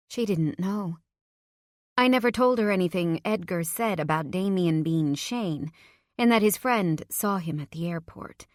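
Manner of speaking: ordinary delivery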